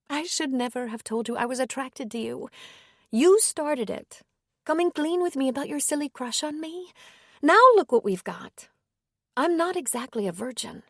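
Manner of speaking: woman's voice. A narrator's reading. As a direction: lift the voice for the hesitant woman.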